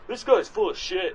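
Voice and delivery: mocking a know-it-all researcher; mock scientist scoff.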